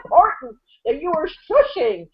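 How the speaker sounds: high snooty mock of a self-important star; mocking Peyton. Host mocking Peyton shushing the crowd, high snooty sneer. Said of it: "talking about the shushing".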